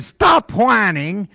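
loud, high, slow preacher's order; pastor's command. Acted. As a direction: raise it and punch it out from the pulpit. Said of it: "Pastor said".

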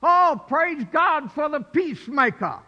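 preaching shout. Real, not acted. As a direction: proclaim it to the church. Preacher exclaims praise.

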